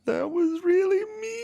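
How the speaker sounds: very high, slow crying imitation; hammed-up about-to-cry squeal; mock tearful mom. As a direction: whine it out like a wounded mom. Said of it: "Just starts crying".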